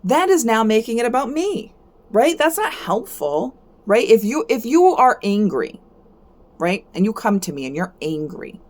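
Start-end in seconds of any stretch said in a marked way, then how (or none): none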